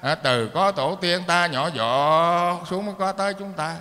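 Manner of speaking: normal talking voice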